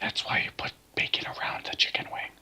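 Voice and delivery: fast secretive whisper. Pretend. Whispered joke exchange.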